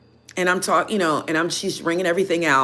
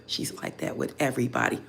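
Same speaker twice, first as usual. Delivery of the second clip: hushed whisper, lower and soft; 7 semitones lower, 11 dB quieter than usual. Retold or imitated. The other customer telling her quietly, a whisper. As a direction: let it slip as a secret aside. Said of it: "she whispered".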